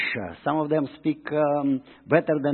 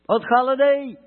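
rhetorical question; 8 semitones higher, 5 dB louder than usual. Real, not acted.

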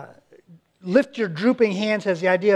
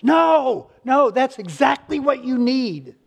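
higher and louder, firmly insisting; emphatic rebuttal; 5 semitones higher, 4 dB louder, slower than usual. Real, not acted. Preacher answering a struggling believer, emphatic and loud. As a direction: knock the excuse down with force.

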